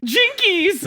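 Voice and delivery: high, loud surprised cry; Velma exclaiming. Acted. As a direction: gasp it like Velma. Velma catchphrase.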